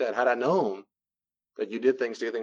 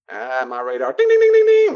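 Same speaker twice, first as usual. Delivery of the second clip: radar alarm imitation; 10 dB louder than usual. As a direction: sound the alarm like a ringing bell.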